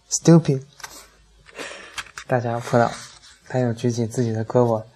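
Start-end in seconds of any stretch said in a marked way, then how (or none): 0.1-0.6 triumphant, higher and louder burst; happy shout. A narrator's reading. Friend remembering the word at last, happy shout. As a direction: blurt out the word you finally found. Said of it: "高兴地喊".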